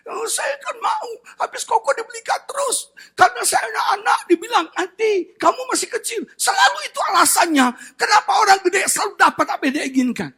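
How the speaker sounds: put-on high-pitched aggrieved little boy; mock tearful kid complaint; whining child. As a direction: imitate a kid griping that it's unfair. Voices the upset little nephew complaining.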